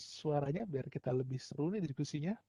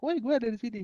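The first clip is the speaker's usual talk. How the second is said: higher and louder yell; mock shout; 8 semitones higher, 7 dB louder, faster than usual. Phone yelling again with no answer, higher louder mock shout. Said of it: "dia teriak".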